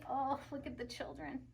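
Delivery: ordinary delivery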